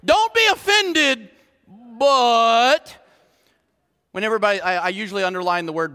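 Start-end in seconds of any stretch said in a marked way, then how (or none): 0.0-3.0 imitated disclaimer. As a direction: exaggerate the stock phrase, leaning on "but". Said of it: "ever heard that phrase".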